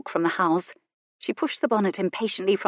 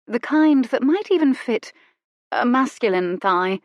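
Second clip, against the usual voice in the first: higher, louder coy lady's voice; coy young lady; 5 semitones higher, 4 dB louder than usual. A narrator's reading. Cynthia hinting at garters to Goodkind, coy and higher. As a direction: lift the voice to tease him.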